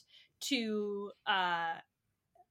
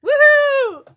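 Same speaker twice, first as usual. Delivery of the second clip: much higher and louder triumphant whoop; cheering crowd; 19 dB louder than usual. Acted. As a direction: whoop like a whole crowd celebrating.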